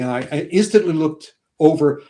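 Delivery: ordinary delivery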